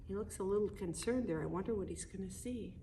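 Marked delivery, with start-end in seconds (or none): none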